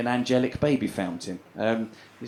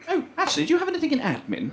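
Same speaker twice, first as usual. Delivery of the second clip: high, softer polite enquiry; suddenly cured jobseeker; 12 semitones higher, 4 dB quieter, faster than usual. Retold or imitated. Slapped jobseeker asking about work, high polite voice.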